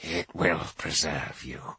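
slow, low, cold reassurance; cold low priest. Acted. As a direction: say it with a wintry calm. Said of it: "a wintry smile".

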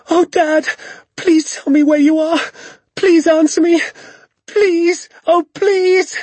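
high, loud, tearful begging; trembly desperate pleading. A narrator's reading. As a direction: cry out to a missing father, close to tears. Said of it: "my voice began to go all trembly".

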